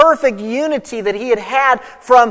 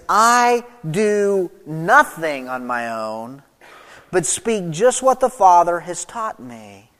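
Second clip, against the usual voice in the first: drawn out, lower, every word weighed; slow deliberate quote; 3 semitones lower, slower than usual. Shown as a demonstration. Preacher slowing to show Jesus' dependence on the Father. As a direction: let each word land slowly. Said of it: "And notice what he says".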